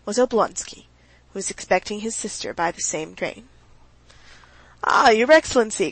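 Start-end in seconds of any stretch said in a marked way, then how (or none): 4.8-5.9 a loud, bright call of greeting; cried out. A narrator's reading. Oblonsky hailing Vronsky on the station steps, a loud cry. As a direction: hail him across the steps. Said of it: "cried Oblonsky".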